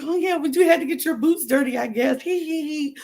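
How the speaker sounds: high-pitched kiki with a fake giggle; mocking, high and giggly; mock giggly gossiping. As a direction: imitate her cackling along, tittering at the end. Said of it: "she's kiki and laughing".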